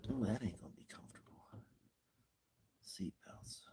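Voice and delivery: quick breathy whisper. Real, not acted. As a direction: whisper it fast to yourself. Quiet talk settling in car.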